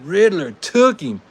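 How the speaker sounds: excited call. Real, not acted. Host calling the Riddler's win, excited. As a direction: celebrate the upset.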